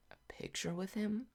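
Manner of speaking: high, hushed incredulous question; baffled question. Retold or imitated. Teen puzzled by mom wanting a picture, incredulous. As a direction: rise softly in disbelief. Baffled reply to mom's request.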